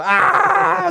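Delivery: very high held mock scream; robot scream. Robot screaming in the background, mock scream. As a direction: scream on one high note. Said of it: "While the robot's just".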